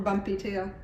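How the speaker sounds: plain reading voice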